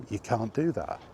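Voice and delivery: ordinary talk, nothing marked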